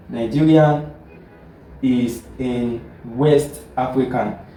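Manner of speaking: slow, spaced out for note-taking; careful dictation pace. Shown as a demonstration. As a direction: say it slowly so students can write. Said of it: "Now let us look at another example".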